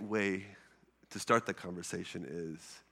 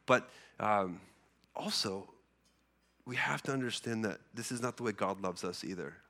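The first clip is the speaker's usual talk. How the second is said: usual speaking voice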